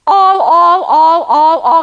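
"all" punched loud each time; hammered repetition. Real, not acted. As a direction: pound every "all". Did you hear all the superlatives?.

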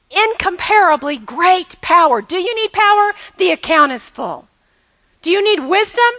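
usual speaking voice

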